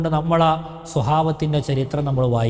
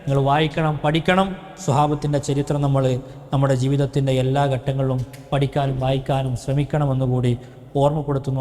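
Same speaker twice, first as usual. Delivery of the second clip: ordinary talk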